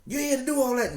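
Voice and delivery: high, loud wife scolding him. Retold or imitated. Wife arguing with husband, high and loud scolding. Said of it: "we got to arguing".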